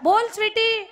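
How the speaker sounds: mock roar of a reply, pitched high; roused tiger reply. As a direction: imitate someone roused from sleep calling back. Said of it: "तो पण असं वाघ झोपेतून उठावा".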